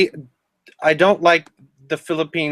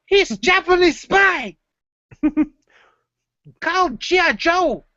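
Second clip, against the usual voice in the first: mock Filipino locals; 12 semitones higher than usual. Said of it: "they're like".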